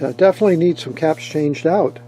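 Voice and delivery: ordinary talk, nothing marked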